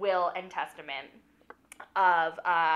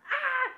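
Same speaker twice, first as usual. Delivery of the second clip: high startled yell; sharp yell; 5 dB louder than usual. Retold or imitated. Storyteller crying out at the fight, sharp yell. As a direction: burst out with a short cry. Dramatic cry in retelling.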